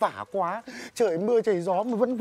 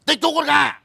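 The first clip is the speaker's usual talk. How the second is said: louder, higher harsh order; loud decree; 7 semitones higher, 7 dB louder than usual. Acted. Landlord confiscating the peasant's rooster, loud decree. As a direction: pronounce the seizure like a verdict.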